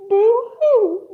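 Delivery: high mock crying. A narrator's reading. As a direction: jeer with a fake, high boo-hoo. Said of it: "the bat mocked".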